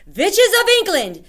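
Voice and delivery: high, loud commanding shriek; Grand High Witch shouting. Acted. Grand High Witch shouting to her assembled witches. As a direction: summon the whole hall to attention. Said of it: "shouted the Grand High Witch".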